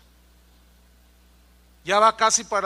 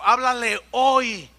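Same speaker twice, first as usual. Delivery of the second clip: slow and loud, háblale hoy with a falling hoy; a last stern urging; final insistent command; 3 semitones higher, 6 dB louder, slower than usual. Retold or imitated. The Spirit's last call to the boy, slow loud command. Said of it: "el último día me dijo".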